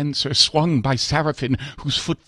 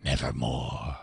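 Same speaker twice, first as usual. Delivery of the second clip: much lower, dark raven voice; raven's reply; 10 semitones lower, 9 dB quieter than usual. Acted. Raven answers the grieving narrator, low and ominous. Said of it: "Quoth the Raven".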